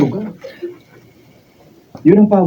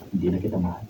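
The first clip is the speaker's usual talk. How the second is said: cold soft finality; 7 semitones lower, 12 dB quieter, faster than usual. Acted. Husband ends the marriage.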